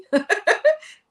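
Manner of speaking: high, loud laugh; burst of laughter. Real, not acted. Laughing at her own money challenge, loud high laughter.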